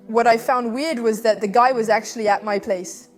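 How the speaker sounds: ordinary talk, nothing marked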